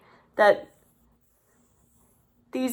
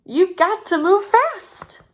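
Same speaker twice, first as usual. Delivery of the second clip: high, louder urgent chicken voice; urgent warning; 9 semitones higher, 5 dB louder than usual. A narrator's reading. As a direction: hurry them along, pitched up. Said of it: "Pete warned".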